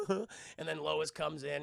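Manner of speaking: ordinary talk, nothing marked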